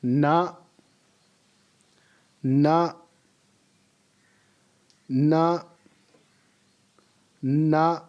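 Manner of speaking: "na" short and steady; repeat after me. Shown as a demonstration. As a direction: model "na" for the class. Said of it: "Repeat it after me".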